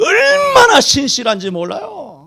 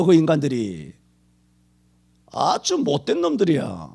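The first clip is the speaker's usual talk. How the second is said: ordinary talk, nothing marked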